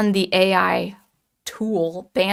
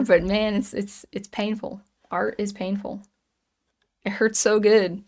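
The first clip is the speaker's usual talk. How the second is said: ordinary delivery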